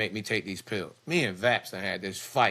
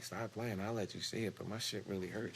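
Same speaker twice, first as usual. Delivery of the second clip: hushed; 10 dB quieter than usual. Retold or imitated. Hushed reply to the nurse.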